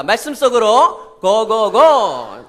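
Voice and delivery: slow, loud chant-like call; slow, loud call into the story; slow cheer. Shown as a demonstration. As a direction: lead the kids in a drawn-out cheer. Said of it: "고고고 하는 거예요, 다 같이".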